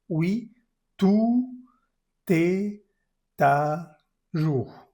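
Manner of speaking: slow separated words, tout high; syllable by syllable. Shown as a demonstration. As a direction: tout pitched high and loud, pauses between words. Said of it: "teacher chunks the phrase".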